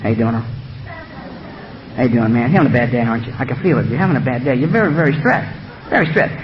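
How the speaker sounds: low, soft, coaxing tempter; whispering beast. Acted. The beast whispering to Earl while he sweeps, softly coaxing. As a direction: murmur it low and soft, sly sympathy in the ear. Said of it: "the beast whispers up and say".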